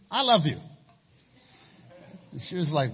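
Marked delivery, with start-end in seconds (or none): 0.1-0.6 matter of fact. Retold or imitated. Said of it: "Just very matter of fact, all logic".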